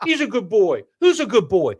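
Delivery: high sing-song praise for a pet; dog praise. Acted. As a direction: praise him like a good puppy.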